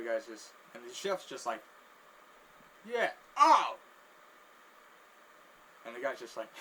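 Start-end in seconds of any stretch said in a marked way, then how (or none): 2.9-3.8 loud, high-pitched whoop; exclaiming chef. Acted. Chef's exaggerated reaction.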